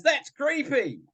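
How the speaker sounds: put-on high, squeamish teenage boy; mock the son's high-pitched disgust.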